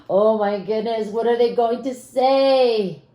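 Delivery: ordinary delivery, nothing marked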